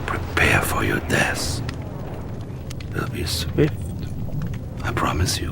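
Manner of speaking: sinister whispered threat; whispered promise of death; threatening whisper. Acted. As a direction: breathe the threat coldly. Villain threatens general.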